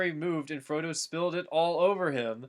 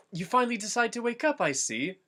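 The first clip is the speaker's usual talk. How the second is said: Frodo voiced; 9 semitones higher than usual. A narrator's reading. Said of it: "Frodo said".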